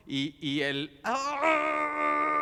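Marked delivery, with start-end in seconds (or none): 1.0-2.4 joyful gasp. Acted. Joyful forgetting of pain.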